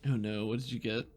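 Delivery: ordinary talk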